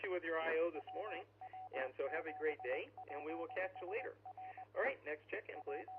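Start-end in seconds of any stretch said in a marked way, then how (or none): none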